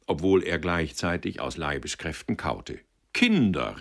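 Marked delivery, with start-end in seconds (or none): 3.1-3.8 louder call to the company. A narrator's reading. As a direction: hail the table to rouse them. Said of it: "rief er".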